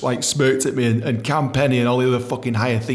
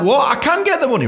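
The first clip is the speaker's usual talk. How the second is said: panicked protest; 14 semitones higher, 4 dB louder than usual. Retold or imitated.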